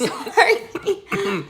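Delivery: sorry through a laugh; giggling apology. Real, not acted. Apologising mid exercise, laughing.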